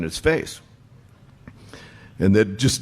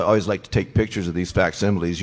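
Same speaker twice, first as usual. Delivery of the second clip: ordinary talk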